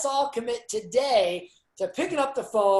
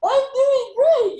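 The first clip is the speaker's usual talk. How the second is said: much higher, louder, slow and beaming; exaggerated cheery reply; 9 dB louder, slower than usual. Shown as a demonstration. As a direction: overdo the cheer as if smiling with the eyes behind a mask. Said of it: "much more pronounced with your eyeballs".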